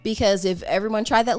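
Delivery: normal talking voice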